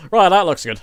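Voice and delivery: usual speaking voice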